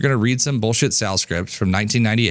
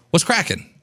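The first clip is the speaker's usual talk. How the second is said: casual greeting; 7 semitones higher than usual. Shown as a demonstration. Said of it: "That's my vibe".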